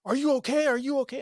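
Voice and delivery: high-pitched, loud, panicky repeated question; imitated yelling. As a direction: mimic a frantic mother yelling through a door. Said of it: "she's, like, yelling at her".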